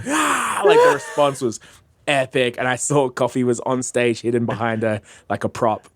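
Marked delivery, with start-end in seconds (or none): 0.1-0.6 high-pitched mock cheer of a crowd; imitated crowd roar. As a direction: imitate a crowd going wild. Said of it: "they were just like".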